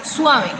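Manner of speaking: "suave" as two syllables, SWA-ve; diphthong joined. Shown as a demonstration. Teacher showing how she says it, diphthong joined. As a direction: run u into a in "suave". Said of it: "yo digo suave".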